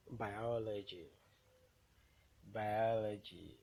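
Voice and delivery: Biology with OL loud and drawn out; teacher's demo of bi-OL-ogy; stressed syllable. Shown as a demonstration. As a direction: lean hard on the second syllable of Biology. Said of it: "the one that will be pronounced louder".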